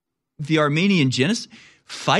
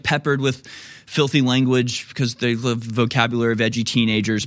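normal talking voice

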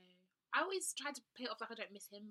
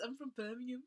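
mock Brummie accent; 3 semitones higher than usual. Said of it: "Is that what you can do?".

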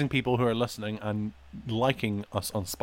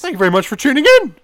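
rushed sign-off; 10 semitones higher, 9 dB louder, much faster than usual. Real, not acted. Hurried sign-off.

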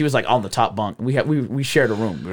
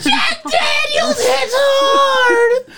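hysterical, very high squealed shouting; mad laughing fit; much slower than usual. Real, not acted. Drunk friend losing it among friends, high hysterical laughing shrieks. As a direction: squeal the words through helpless laughter. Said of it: "go into a mad laughing fit".

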